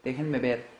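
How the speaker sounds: ordinary talk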